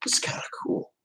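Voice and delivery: hushed whisper, impressed; reacting to the English-accent video, whispered; whispered aside. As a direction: whisper it like a secret thrill. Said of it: "I'm like".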